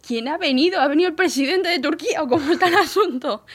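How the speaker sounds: loud, high, exasperated questioning; incredulous demand. Retold or imitated. Employee asks who caused all the fuss, incredulous.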